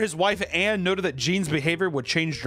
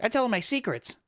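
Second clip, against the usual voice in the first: put-on higher little-kid voice; creepy kid voice; 8 semitones higher than usual. Young cousin bragging about his doll, high voice. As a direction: say it like an eerie child again. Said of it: "he'd be like".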